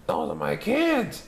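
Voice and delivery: put-on high scratchy grumble of an old woman. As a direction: crackle it out like a cranky old lady. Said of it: "she said in a scratchy voice".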